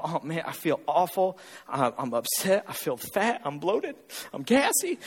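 mock moaning, pitched low; complaining gym-goer. Returning gym-goer complaining to the trainer, low mock moan. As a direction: imitate someone griping about their body, low. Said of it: "they'll go".

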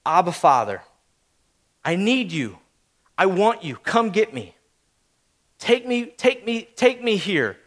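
soft, spaced-out plea; needy child praying. Acted. Child calling on Father God, soft and pleading. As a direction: surrender gently, phrase by phrase. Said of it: "the child that goes".

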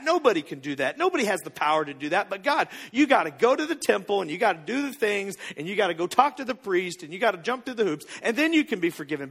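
ordinary talk

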